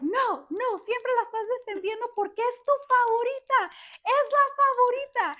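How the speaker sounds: high, loud Spanish protest; Mikey shouting. Retold or imitated. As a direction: imitate an aggrieved son yelling at dad. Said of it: "he was shouting and it was echoing".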